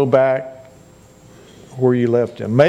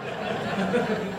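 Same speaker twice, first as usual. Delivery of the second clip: soft laugh; 6 semitones higher, 11 dB quieter than usual. Real, not acted. Laugh at the story's punchline.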